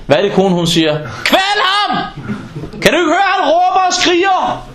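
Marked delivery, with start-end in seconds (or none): 1.2-4.7 high, exasperated nagging wife; hammed-up irritated wife voice; shrill annoyed wife. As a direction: mimic a fed-up wife snapping at the noise. Wife shrieking about nephew.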